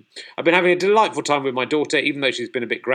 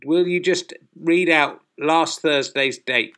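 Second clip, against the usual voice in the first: slow over-clear command; 4 semitones higher, slower than usual. Acted. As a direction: speak slowly and clearly to a machine. Pleading with the phone.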